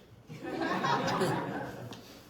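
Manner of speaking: a loud burst of laughter at her own joke; hearty laugh. Real, not acted. To the council, laughing at her own quip. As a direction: laugh out loud, breathy and unvoiced.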